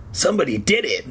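plain reading voice

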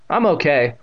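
put-on slightly unsure boy; uncertain. A narrator's reading. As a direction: answer with a touch of doubt. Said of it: "Evan said uncertainly".

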